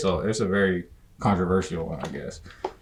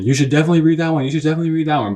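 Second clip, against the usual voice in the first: higher, faster mock nagging; imitating the viewer; 6 semitones higher, 4 dB louder, much faster than usual. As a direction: rattle it off as a pestering viewer, pitched up. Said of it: "I know what you're thinking".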